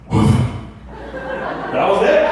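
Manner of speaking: unvoiced mimicked sound of the toy; breathy imitation of the dildo noise; imitated noise. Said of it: "it went like this".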